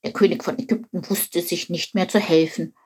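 plain reading voice

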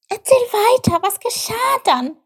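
high, loud eager squeak; Chip urging. Acted. Chip begs buffalo to continue, high eager plea. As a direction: urge the storyteller on in a high voice.

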